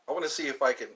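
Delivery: ordinary delivery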